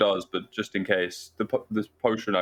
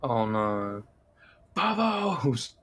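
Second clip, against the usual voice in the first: mock lament; much slower than usual. Player laments the frog spirit, mock-tragic wail.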